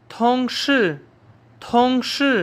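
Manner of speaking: teacher modelling थोंगशी; silent i. Shown as a demonstration. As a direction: say थोंगशी as tóngshì, swallowing the i. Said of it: "जी थोड़ा सा silent हो जाता है".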